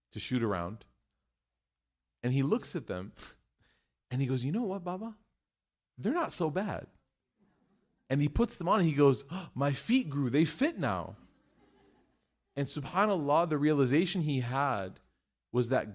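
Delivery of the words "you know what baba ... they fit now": put-on light child voice. Son voiced, content.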